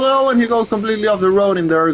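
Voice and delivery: excited race call. Real, not acted. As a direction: shout the spin-off as it happens. Car goes off the road.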